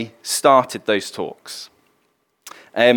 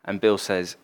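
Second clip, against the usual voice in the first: usual speaking voice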